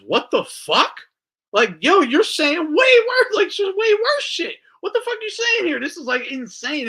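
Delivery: high, loud incredulous shouting; outraged yelling. Retold or imitated. Friend yelling at the pedo hunter in disbelief, loud and high. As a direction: yell it in disbelief at the guy.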